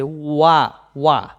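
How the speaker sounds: ওয়া isolated as "wa", said twice; teacher separating the first sound, ওয়া; sound demo. Shown as a demonstration. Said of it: "তার মানে ওয়ান্স আসতেছে".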